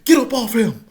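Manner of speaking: loud, high barking orders; referee yelling. Retold or imitated. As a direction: pull them apart by voice. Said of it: "So he like".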